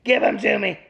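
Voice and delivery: high, eager dog voice; voicing the dog. Owner voicing her dog begging for treats, in an eager high voice. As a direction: speak for the greedy little dog up high. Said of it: "He said".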